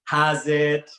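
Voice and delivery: brief mock South African greeting; attempted South African accent, loud. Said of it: "in a South African accent".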